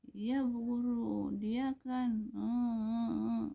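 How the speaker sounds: put-on high, loud child's excuse; drawn-out, high-pitched mock child voice; whining child. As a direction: imitate a pupil stalling for a reason. Child answering the teacher's question.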